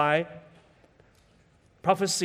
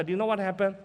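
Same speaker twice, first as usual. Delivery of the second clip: normal talking voice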